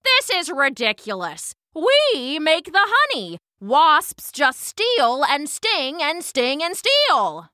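high, indignant, drawn-out accusation; angry outburst. A narrator's reading. Queen bee accusing the wasps of stealing, indignant. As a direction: accuse them, hitting each key word hard. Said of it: "shouted the queen bee".

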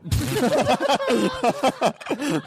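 big high burst of laughter. Real, not acted. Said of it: "ooh, that was".

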